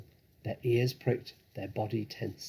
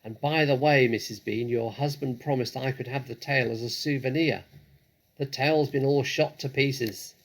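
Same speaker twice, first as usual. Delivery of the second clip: louder voiced calls between floors; loud calling; 4 semitones higher, 8 dB louder than usual. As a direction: call it up the stairs. Women calling across floors.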